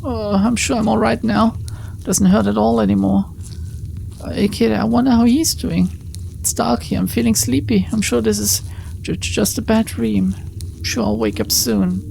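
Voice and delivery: fading heroine. Acted. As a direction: drift off as if dreaming. Dying heroine drifting off.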